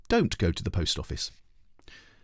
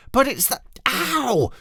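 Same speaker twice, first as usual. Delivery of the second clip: very high, slow cross yelp; girl yelping crossly at her brother; 4 dB louder, slower than usual. A narrator's reading. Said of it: "yelped Ketchup Face crossly".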